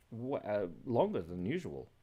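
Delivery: ordinary talk, nothing marked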